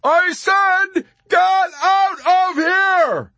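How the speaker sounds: high, slow, word-by-word scream; desperate shout. A narrator's reading. Yossarian near tears at Arfi, desperate shout. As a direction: shout each word out long. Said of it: "Yossarian shouted and broke into tears".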